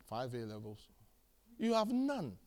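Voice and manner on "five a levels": much lower, hushed reply; under-the-breath acted answer; sheepish mock answer. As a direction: drop low and quiet, playing the liar. Acted answer from lying job applicant.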